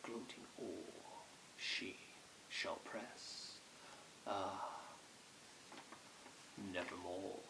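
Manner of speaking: hushed, slow, halting lament; grieving. A narrator's reading. Narrator realising she will never return, hushed grief. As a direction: let it sink softly into sorrow.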